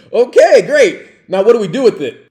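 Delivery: loud, high, fast alarm; mock panic. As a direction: replay your own panic, loud and high. Acts own panic at live grenade.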